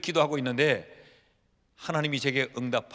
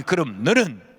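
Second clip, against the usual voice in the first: a short, louder retort; God's blunt retort; 8 dB louder, slower than usual. Retold or imitated. God's curt answer to the complaining pastor, louder. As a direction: snap it back flatly. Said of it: "하니까 하나님이".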